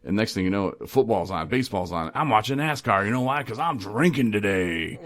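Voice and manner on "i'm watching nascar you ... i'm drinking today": boozy sports fan. Acted. Recovering drinker mimics his old excuses, rowdy boozy fan.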